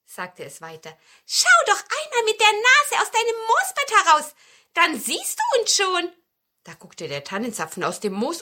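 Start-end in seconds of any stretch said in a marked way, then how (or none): 1.3-6.1 high, loud, cheeky invitation; piping little pine cone. A narrator's reading. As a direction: call out in a piping voice from hiding. Hidden cone invites him to look. (hohe, freche Zapfenstimme)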